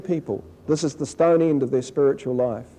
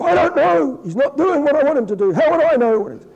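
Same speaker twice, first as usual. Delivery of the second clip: much higher, louder whiny reply; mock the petulant churchgoer; sulky complainer; 11 semitones higher, 4 dB louder than usual. Mocking the sulky believer's reply.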